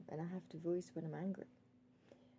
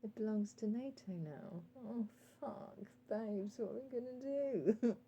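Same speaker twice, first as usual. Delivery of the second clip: higher mock dismay from Russia and America; mock panic; 5 semitones higher, 3 dB louder than usual. Voicing Russia and America realising Ukraine is with NATO, mock panic. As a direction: ham up the great powers' panicked realisation. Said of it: "they sort of realise".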